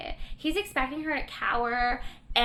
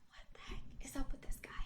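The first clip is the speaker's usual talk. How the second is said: whispered, quick mock aside; hushed aside; 11 dB quieter, much faster than usual. Elizabeth to herself about the ranting boss, quick whispered aside. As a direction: mutter it privately under your breath. Acted unimpressed aside under her breath.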